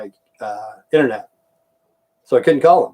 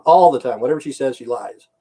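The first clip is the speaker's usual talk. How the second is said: ordinary talk, nothing marked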